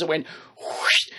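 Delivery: breathy blade swish, no voice; whispered whoosh. Retold or imitated. To viewers, imitating a ninja sword swish. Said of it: "made that noise like on the ninja films".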